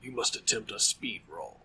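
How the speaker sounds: low, hushed horror narrator; soft, deep Lovecraftian storyteller. Acted. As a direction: deliver the instruction as an ominous decree. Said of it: "Should I do my, uh, Lovecraft voice".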